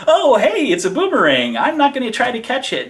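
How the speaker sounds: hammed-up clueless baddie; mock oblivious villain. Villain watching the boomerang, in a loud, dopey mock voice. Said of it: "the enemy's like".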